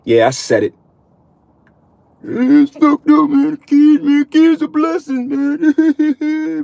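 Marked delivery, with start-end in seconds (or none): 2.2-6.6 high, whiny, drawn-out mock voice; mocking objectors. Mimicking men upset at his remark about kids, high mocking whine. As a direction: squeal it slowly like a scandalised complainer. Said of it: "What is he saying?".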